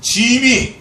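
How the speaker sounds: high, loud, very slow regal 짐이; king's royal we. Retold or imitated. Preacher imitating an old king saying 짐이 grandly. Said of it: "옛날 임금이 그러잖아요".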